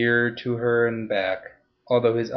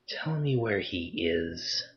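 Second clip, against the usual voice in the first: soft, slow, threatening near-whisper; 3 semitones lower, 8 dB quieter, slower than usual. Acted. Interrogating Torbit up close, a soft slow near-whisper. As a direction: lean in and breathe it slowly, all menace. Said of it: "She spoke softly, almost in a whisper".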